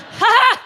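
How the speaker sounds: loud high cackling ha ha; gleeful laugh. Retold or imitated. Comic reenacting her wild laugh, loud and high. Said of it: "I went".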